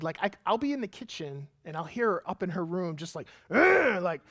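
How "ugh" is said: a loud, high, frustrated ugh; frustrated groan. Retold or imitated. Father imitating his daughter stuck on Legos, groaning. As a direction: groan it like a fed-up child. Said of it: "screaming at the top of her lungs and crying".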